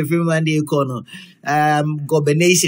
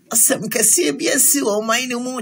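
mock lament; 8 semitones higher than usual. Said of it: "I say".

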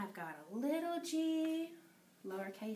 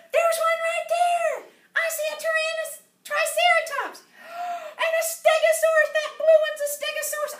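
high, loud shrieking alarm; full-voiced playacted dinosaur scare; pretend excited panic; 13 semitones higher, 14 dB louder than usual. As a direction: shout and gasp as if dinosaurs are attacking.

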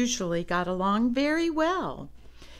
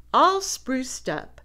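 ordinary delivery, nothing marked

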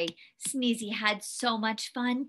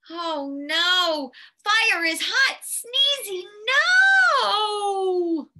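higher warning rising to a long cry of no; mock alarm; 6 semitones higher, 6 dB louder, slower than usual. Storyteller warning the snowman off the fire, mock alarm. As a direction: plead loudly, stretch the final no.

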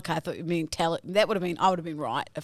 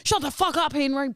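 mock sibling snap; 7 semitones higher than usual. Acting a squabble between the kids.

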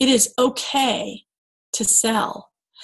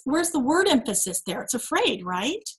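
ordinary delivery

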